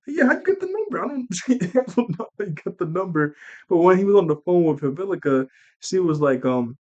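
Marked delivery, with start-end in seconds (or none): 0.1-3.3 high, baffled mock voice; squeaky puzzled impression; mock confused. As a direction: play it dumb and high-pitched.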